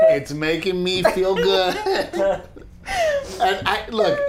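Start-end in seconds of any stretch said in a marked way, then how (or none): 2.5-3.4 high squealing burst of laughter; a sudden high giggle. Real, not acted. Husband enjoying insults to his wife, high squealing laugh.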